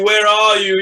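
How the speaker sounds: loud repeated call; anxious loud call repeated; calling again. Acted. Calling out for the servant.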